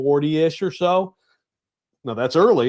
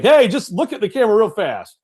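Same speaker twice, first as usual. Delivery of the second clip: put-on raised, louder plea to a reluctant kid; imitating an exasperated photo-taking dad; urgent coaxing; 6 semitones higher, 6 dB louder than usual. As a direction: call out to get the kid's attention.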